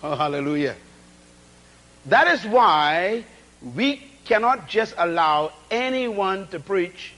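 normal talking voice

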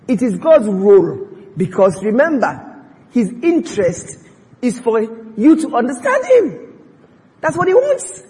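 loud, high preaching climax; full-force preaching. Real, not acted. Preacher tells congregation God wants to be understood, full-force preaching. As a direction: drive it up into a ringing proclamation.

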